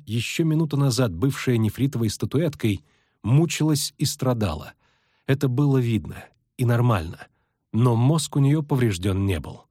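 ordinary delivery

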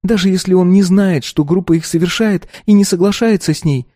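put-on high, light girlish voice; young girl voice. A narrator's reading. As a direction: voice the earnest teenage daughter. Narrator voices the daughter.

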